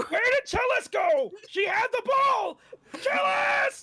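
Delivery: mock freak-out. Player fakes panic to sell the swap, high shrieking. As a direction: fake a frantic search, yelling her name. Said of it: "freaking out".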